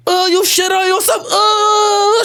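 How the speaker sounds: high, disgusted jeer; hammed-up mocking woman's voice; mocking nurse. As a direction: laugh in the kid's face with revulsion. Acted nurse mocking him.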